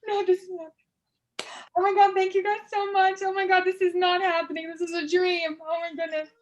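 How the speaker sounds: high, breathless disbelief of a winner; hammed-up pageant winner shock; mock winner's reaction. Shown as a demonstration. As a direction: act stunned at winning the crown. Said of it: "Oh, that's my reaction".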